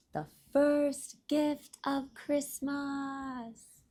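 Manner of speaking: high, slow, drawn-out proclamation in a changed voice; Santa calling out. A narrator's reading. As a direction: announce it like Santa to his elves. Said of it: "called out".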